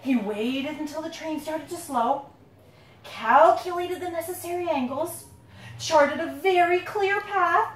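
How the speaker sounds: building excitement. A narrator's reading. Parent reading the escape to the kids, building to a joyful shout. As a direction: race toward the door, then cry out.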